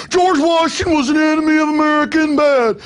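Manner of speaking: put-on high, loud country-boy parody; country boy accent. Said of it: "I'm doing a country boy accent".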